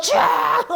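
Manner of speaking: drawn-out shouted burst; long hammed-up yell; imitated prayer cry. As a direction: mimic a straining prayer shout. Imitating all-night prayer shout.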